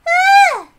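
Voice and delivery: put-on high loud childish shout of "Ah!"; a little girl yelping out loud; sudden high-pitched yell; child's high cry. Child cries out.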